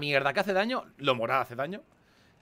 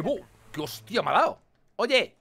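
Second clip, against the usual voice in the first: ordinary delivery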